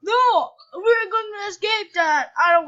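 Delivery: loud, high shout of alarm; urgent gamer panic yell; panicked shout. Real, not acted. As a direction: yell to get away from danger. Danger in the game.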